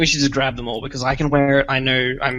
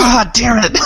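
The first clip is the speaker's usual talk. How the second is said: frustrated; 9 semitones higher, 6 dB louder than usual. Acted. Missing focus found.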